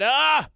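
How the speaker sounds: loud high mock gasp of alarm; fish exclaims. The fish spots the goats at sea and cries out loud and high. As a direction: cry out as the outraged fish. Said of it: "the fish said".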